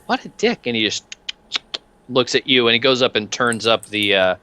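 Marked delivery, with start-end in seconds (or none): 0.1-0.7 high, sneering exclamation; cheerful thug voice. Acted.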